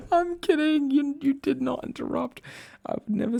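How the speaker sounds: high, squeaky talking through laughter; laughing. Real, not acted. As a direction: giggle through the words as you take back a tease. Laughs while taking back a tease.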